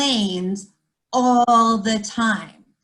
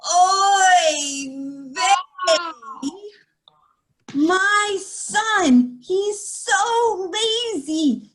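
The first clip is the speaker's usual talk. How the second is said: put-on kvetching old woman; 6 semitones higher than usual. Meshka wailing to the teacher about her children. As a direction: wail your woes dramatically. Meshka's loud complaint, kids join.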